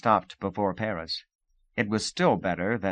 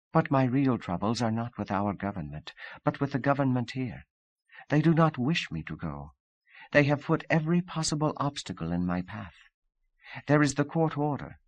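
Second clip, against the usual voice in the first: put-on higher, lightly accented Russian woman pleading her case; Sonia, rising complaint; 5 semitones higher than usual. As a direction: complain a little higher as it builds.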